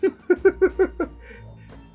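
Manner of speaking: high shrieking villain cackle; maniacal laugh. Acted. Captor gloating over the drugged guards, maniacal cackle. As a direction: cackle with glee.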